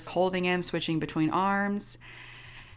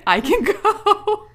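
high, loud, slow, breaking into laughter; laughing; 14 semitones higher, 6 dB louder, slower than usual. Real, not acted. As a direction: laugh through the punchline. Laughs at her own punchline.

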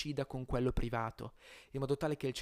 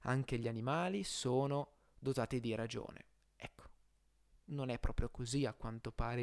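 ordinary delivery

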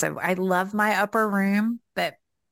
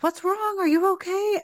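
much higher retold worried questions; imitating concerned husband; 14 semitones higher than usual. Husband checking on his crying wife, retold in high concern. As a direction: voice a husband's startled concern, pitched way up.

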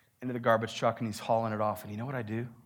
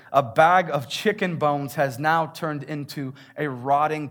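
ordinary delivery

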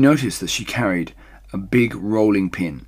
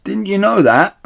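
loud, high scornful question; calling down; 13 semitones higher, 9 dB louder than usual. Acted. Missus Bean calls down scornfully, loud and high. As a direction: needle her from upstairs.

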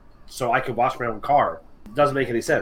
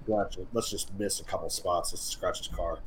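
spiteful mutter; 8 semitones lower, 14 dB quieter than usual. Retold or imitated. Car washers' spiteful mutter.

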